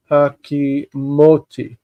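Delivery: hakimoti slowly, syllable by syllable; careful, slow teaching pronunciation; slow sounding-out. Shown as a demonstration. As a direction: stretch out hakimoti for learners. Teacher sounds out biblical form.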